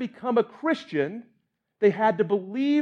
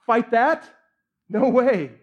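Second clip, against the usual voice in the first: soldier's refusal; 3 semitones higher, 5 dB louder, slower than usual. Acted. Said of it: "one of the soldiers in the front row".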